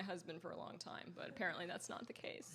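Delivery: unvoiced quick whisper; hushed, quick confidential aside. Real, not acted. Quiet aside about husband.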